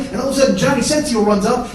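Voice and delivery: ordinary delivery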